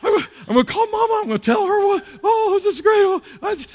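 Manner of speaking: high, squealing excitement; hammed-up squeal of delight; mock overjoyed fiancée. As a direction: gush breathlessly in a very high falsetto. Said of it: "No, you really".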